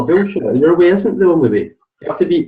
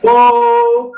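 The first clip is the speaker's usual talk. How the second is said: delighted whoop. Real, not acted. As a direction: cheer her answer with a gleeful whoop and laugh. Coach reacts to right answer.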